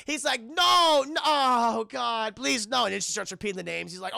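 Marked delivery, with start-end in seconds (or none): 0.4-2.8 desperate pleading. Retold or imitated.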